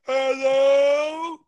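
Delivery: drawn-out loud hello. Real, not acted. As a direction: stretch out Hello? as a loud call. Calling out over a bad line.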